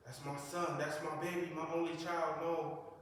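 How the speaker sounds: mother crying over her shot son; mother's wailing. Retold or imitated. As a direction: rise into a wail for your only child. Retelling his mother's cry.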